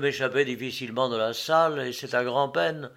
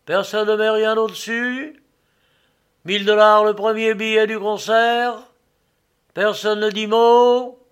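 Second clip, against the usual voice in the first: high, loud auctioneer's sing-out; auctioneer calling out; 7 semitones higher, 10 dB louder than usual. A narrator's reading. Agent asks the hall for higher bids, loud auctioneer call. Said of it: "répétait l'agent du contrôle".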